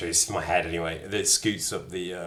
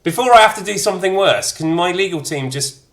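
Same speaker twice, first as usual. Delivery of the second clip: much higher and louder, protesting; mock outrage; 9 semitones higher, 7 dB louder than usual. Real, not acted. Shifting blame onto Radzig in loud mock outrage. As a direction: whine that it's someone else's fault. Jokingly blaming Radzig over dialogue.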